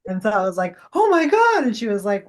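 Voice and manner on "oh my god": high, loud retold gasp of realization; shocked exclamation. Medium telling the client of her realization, shocked exclamation. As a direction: blurt it out, stunned, well above usual pitch.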